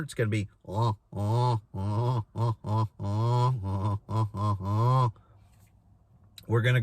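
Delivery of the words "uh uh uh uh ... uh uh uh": low guttural uh-uh murmur; goose murmur. Shown as a demonstration. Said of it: "if you're looking for the murmur".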